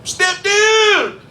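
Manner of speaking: high, drawn-out holler; mock stepdaughter shriek, last word stretched; excited stepdaughter yell. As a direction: imitate her calling out at full pitch. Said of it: "we pulling in and she's like".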